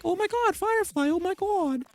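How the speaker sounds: high, hammed-up gasping bystanders; mock crowd gasp. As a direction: play the panicked onlookers, overdone. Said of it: "everybody's like".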